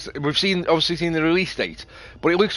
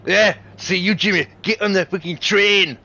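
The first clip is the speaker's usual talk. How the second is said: higher, louder mock Scottish accent; 6 semitones higher, 4 dB louder than usual. Streamer trying a Scottish accent on the train, loud mock Scottish. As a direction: bark it in a jokey Scottish accent. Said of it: "if I try a Scottish accent".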